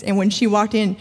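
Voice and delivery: ordinary delivery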